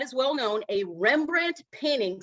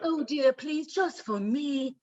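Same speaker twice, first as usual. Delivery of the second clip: drawn-out pampered socialite plea; mock posh wife begging sweetly; Mrs. Howell mode; 3 semitones higher, slower than usual. Wife pleading with husband, hammed-up posh. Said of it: "Missus Howell mode".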